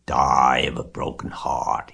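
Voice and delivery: slow, drawn-out sneering echo; mocking mimicry. Templeton mimics Wilbur's plea, mocking mimicry. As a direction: mimic it with scornful slowness. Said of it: "he mimicked".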